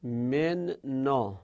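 من نا said very slowly, min and na split apart; teacher sounding out من نا slowly; syllable by syllable. Shown as a demonstration. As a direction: split من نا slowly to show the merged n. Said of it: "اینجا هم ضمیر جری هست".

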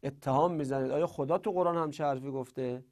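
usual speaking voice

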